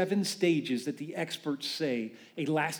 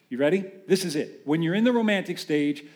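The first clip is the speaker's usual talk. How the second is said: normal talking voice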